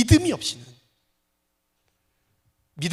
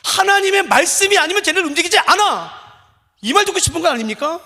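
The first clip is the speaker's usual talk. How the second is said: loud, high, driving sermon climax; full-force preaching; 9 semitones higher, 8 dB louder than usual. Acted. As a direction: hammer each clause home to the congregation.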